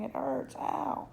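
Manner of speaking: high, whining cry of pain; pained yell. Acted. Boy with a wounded cheek, crying in pain. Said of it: "Patrick kept yelling".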